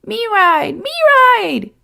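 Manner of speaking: put-on high little-boy plea; begging toddler. A narrator's reading. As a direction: beg like a small child. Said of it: "he begged".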